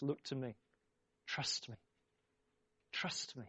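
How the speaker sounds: soft, hushed invitation to trust; tender voice of God. Acted. Preacher voicing God tenderly to his children. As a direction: coax gently, like a father.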